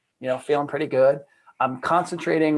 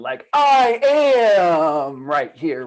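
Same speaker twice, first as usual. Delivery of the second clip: slow awe; 4 semitones higher, 6 dB louder, much slower than usual. Retold or imitated. Reliving awakening moment.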